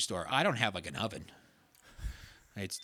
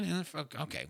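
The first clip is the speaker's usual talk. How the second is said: ordinary delivery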